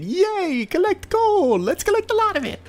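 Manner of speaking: very high, chirpy mock cheer; high squeaky hype voice; squeaky cheerleader voice. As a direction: cheer yourself on like a cartoon kid. Cheery high mock voice urging himself.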